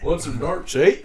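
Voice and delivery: Canadian accent hammed up with a laugh. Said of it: "Canadian accent?".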